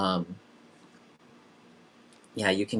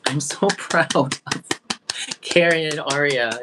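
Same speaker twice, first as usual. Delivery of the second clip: gushing with laughter; 7 semitones higher, 5 dB louder than usual. Real, not acted. Host gushing over brave participants.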